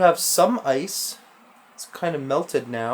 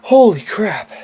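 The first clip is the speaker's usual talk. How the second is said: loud, shocked outburst; startled exclamation; 4 semitones higher, 10 dB louder than usual. Real, not acted. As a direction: blurt it out in real shock. Startled by something in the dark.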